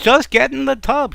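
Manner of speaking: loud, high-pitched exasperated order; exasperated shout. Acted. Husband insisting again, louder.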